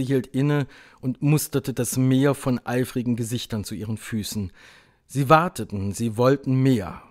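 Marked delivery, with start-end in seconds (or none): none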